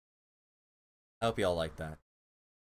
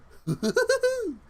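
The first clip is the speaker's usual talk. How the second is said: high squealing laugh; embarrassed laugh; 3 dB louder than usual. Real, not acted. Streamer laughing at her awful Tigger, high squeal. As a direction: crack up at yourself.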